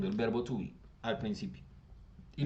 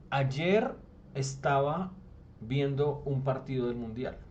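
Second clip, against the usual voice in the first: slow example sentence; slower than usual. Shown as a demonstration. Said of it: "Si yo digo".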